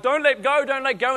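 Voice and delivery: put-on high, loud panicky kid pleading; scared child. Child scared on wobbly bike.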